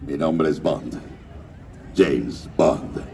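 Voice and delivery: deep, low suave Bond delivery; low smooth secret agent voice; James Bond voice. Shown as a demonstration. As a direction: drop the voice way down, cool spy. Voice actor doing Bond line.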